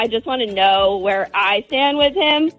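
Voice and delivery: usual speaking voice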